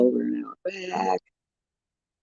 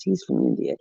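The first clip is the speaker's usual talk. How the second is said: normal talking voice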